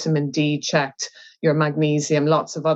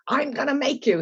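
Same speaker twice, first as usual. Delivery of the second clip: high-pitched insistent brain voice; mock threat in a much higher pitch; bossy brain; 9 semitones higher than usual. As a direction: lay down the law as the brain, pitched way up. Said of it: "And the brain goes".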